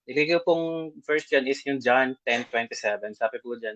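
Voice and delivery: ordinary talk, nothing marked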